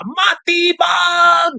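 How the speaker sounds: hammed-up taunting call of Matibag; mock challenging shout. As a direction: bellow the name slowly like a dare. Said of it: "Ganyan pa siya magsalita".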